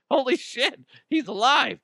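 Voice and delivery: laughing, high-pitched gasp of disbelief; a mourner sees the dead man sit up and squeals in surprise; mourner's shock. Retold or imitated. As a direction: blurt it like a stunned relative. Mourners react to revival.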